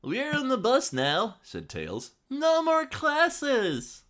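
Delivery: put-on high, slow, squeaky boy voice; Tails voice. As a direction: squeak it out like a kid fox. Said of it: "the best Tails voice".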